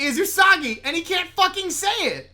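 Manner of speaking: loud, high, emphatic outburst; worked up. Real, not acted. Co-host arguing why nobody suspects an attack, loud and worked up. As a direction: drive the point home, rising toward a shout.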